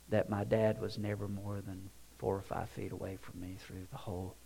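soft, low, understated emotion; hushed, halting voice of a moved son; retold tearful realization. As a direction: tell it quietly, holding back tears. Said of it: "his eyes began to mist, and you could see the tears".

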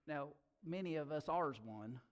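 ordinary talk, nothing marked

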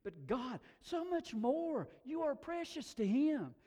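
high, loud, drawn-out proclamation; impassioned preaching. Real, not acted. Preacher telling the congregation they are precious, impassioned. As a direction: preach it at full force.

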